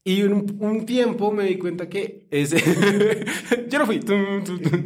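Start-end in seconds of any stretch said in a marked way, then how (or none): 2.6-3.6 short high laugh. Real, not acted. Laughs at his own Bart bit. 3.7-4.9 high playful Bart imitation; sing-song it high like Bart; mock Bart catchphrase. Mimics Bart catchphrase with beat.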